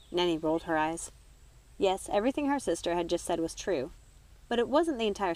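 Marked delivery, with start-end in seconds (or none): none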